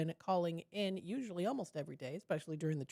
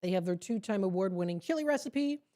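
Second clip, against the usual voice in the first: ordinary delivery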